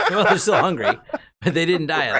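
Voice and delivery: talking through laughter; laughing while talking. Real, not acted. Laughing at the joke.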